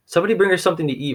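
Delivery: quick, high urgent call for food; fast urgent request. Acted. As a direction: rush the words out, worried for her sister.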